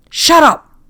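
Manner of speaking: very high, very loud scream; furious scream. A narrator's reading. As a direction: explode at him. Captain explodes.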